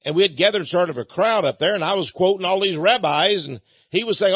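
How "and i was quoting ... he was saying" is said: ordinary talk, nothing marked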